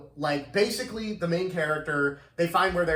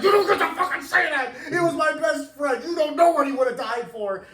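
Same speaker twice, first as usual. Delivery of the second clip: hammed-up yell, much higher and louder; mock shout; 10 semitones higher, 4 dB louder than usual. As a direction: parody the hero's outburst. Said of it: "screaming shit fit".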